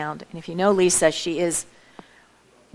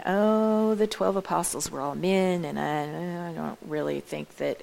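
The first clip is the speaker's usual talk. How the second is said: imagined doubtful listener; 4 semitones lower, 6 dB quieter than usual. Acted. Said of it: "so that you don't be thinking".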